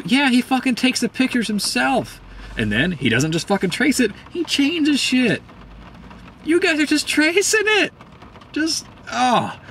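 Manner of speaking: high-pitched, indignant outburst; exasperated rant. Real, not acted. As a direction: let frustration push the voice way up. Exasperated rant against tracing artists.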